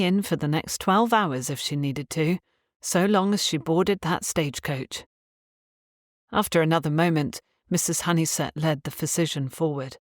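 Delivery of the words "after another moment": ordinary delivery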